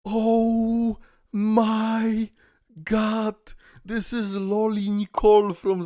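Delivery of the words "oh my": ordinary talk, nothing marked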